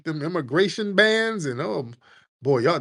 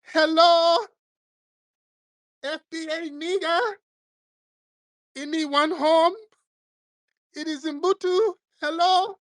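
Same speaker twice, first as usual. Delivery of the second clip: very high, sing-song put-on voice calling out; mock immigrant at door. Immigrant knocking on the door for help in a high mock voice. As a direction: call sweetly through the door.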